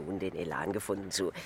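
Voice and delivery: ordinary talk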